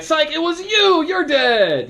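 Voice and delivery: very high, gleeful gotcha shout; spring the trap on the player; gleeful psych-out. Shown as a demonstration. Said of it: "turn around and be like".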